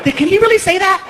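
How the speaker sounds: high, prim disapproving voice; high prissy shock; shocked audience member. Retold or imitated. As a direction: gasp it like a scandalized listener. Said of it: "Some are like".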